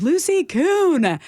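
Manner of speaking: high, slow yell across the street; yelled name. Retold or imitated. Spotting an old teacher across the street, yelling her name high and slow. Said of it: "I just yelled".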